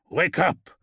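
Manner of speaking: snapped command. A narrator's reading. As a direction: bark him awake with a harsh snap. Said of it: "snapped a guttural voice".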